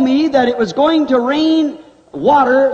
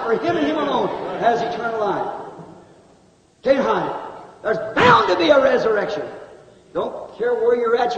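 ordinary talk, nothing marked

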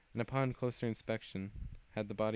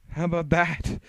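loud taunt; 7 semitones higher, 14 dB louder than usual. Acted. Villain taunts.

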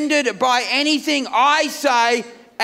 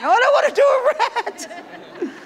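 put-on much higher and slow, whining like a child; whiny squeak; 12 semitones higher, slower than usual. Husband refusing his wife's rapid test, whining high and slow. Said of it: "She said".

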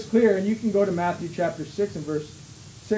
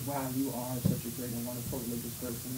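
much lower and softer, fast, awed mock praise; gushing admirer; 8 semitones lower, 12 dB quieter, faster than usual. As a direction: gush at him in a hushed rush. Admiring crowd echoes the boast.

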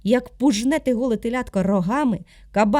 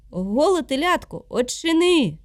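raised, louder boar calling at the door; loud demand; 5 semitones higher, 3 dB louder than usual. Acted. Boar shouting at the calf's door, loud demand. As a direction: demand to be let in.

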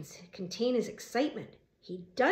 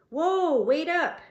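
loud, high-pitched holler; calling out; 7 semitones higher, 7 dB louder, much slower than usual. A narrator's reading. Foreman chasing the squad car, calling out loudly. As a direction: shout to stop someone driving off.

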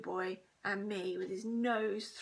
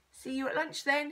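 higher, louder taunting call; crying out; 5 semitones higher, 5 dB louder than usual. A narrator's reading. Bully calls to his victims, crying out. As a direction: call it across to them. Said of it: "he cried".

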